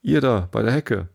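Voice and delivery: the rabbit shouting orders; rabbit calling. Acted. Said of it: "die Stimme des Kaninchens allein".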